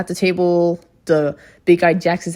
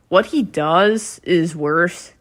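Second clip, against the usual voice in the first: ordinary talk, nothing marked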